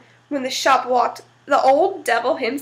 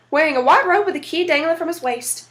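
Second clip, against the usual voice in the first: plain reading voice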